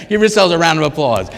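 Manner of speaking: normal talking voice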